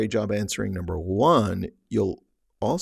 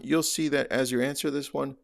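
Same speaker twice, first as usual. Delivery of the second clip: usual speaking voice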